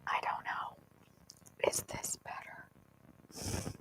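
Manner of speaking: unvoiced test whisper; hushed mic-check whisper. Real, not acted. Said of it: "I'm talking quiet".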